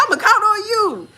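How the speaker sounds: high put-on echo of the doctor; mocking echo. Echoing her doctor's words back, high mocking voice. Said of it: "I said".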